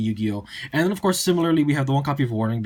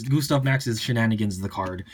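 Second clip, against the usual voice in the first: usual speaking voice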